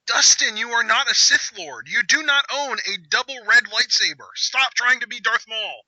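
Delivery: high-pitched, emphatic telling-off; exasperated lecture to a wrestler on screen; mock scolding.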